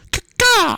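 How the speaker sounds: loud high cawing squawk; crow caws. Acted. Dying bird player cries to friends, loud crow caw.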